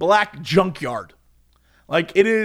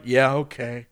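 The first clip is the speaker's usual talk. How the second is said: low, resigned acceptance; mock-defeated, too worn down to argue; beat down; 6 semitones lower, 3 dB quieter than usual. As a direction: give in with a lowered voice. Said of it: "I was so beat down".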